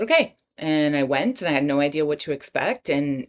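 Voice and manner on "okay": high surprised okay. Retold or imitated. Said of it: "And I just said".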